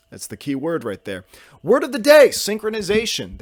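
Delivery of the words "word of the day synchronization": loud, high game-show style proclamation; mock segment announcer. Podcast host announces the word of the day, loud mock announcer. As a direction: belt it out high, then stretch the word.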